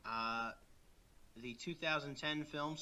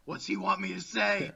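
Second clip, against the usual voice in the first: loud, higher imitation of a flustered co-host; playful put-on panic; mock panic; 4 semitones higher, 8 dB louder than usual. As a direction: act put on the spot, raised voice. Mimics co-host's panic at prompting.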